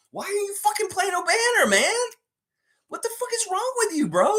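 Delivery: incredulous angry outburst; exasperated. Real, not acted. Ranting at coach.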